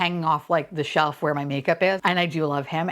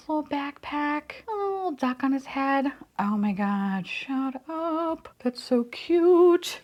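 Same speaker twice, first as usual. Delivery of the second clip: adoring coo; 6 semitones higher, slower than usual. Real, not acted. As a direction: coo over something tiny, drawing out the end. Cooing over the cute toy.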